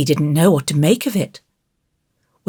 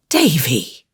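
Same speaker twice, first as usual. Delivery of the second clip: shocked rebuke. A narrator's reading. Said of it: "convinced by Anne's tone".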